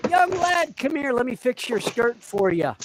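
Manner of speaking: put-on high, teasing old shopkeeper voice, up in pitch; mock shop owner. Shop owner calls the lad over to fix his skirt, high teasing voice. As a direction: hail him over like a cheeky shopkeeper.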